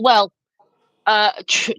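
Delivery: ordinary delivery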